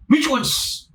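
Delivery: high, eager repeated question; eager excitement. Retold or imitated. Rich man eagerly pressing Jesus, high and keen. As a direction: pitch it up like he can't wait. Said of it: "In the tone he asked".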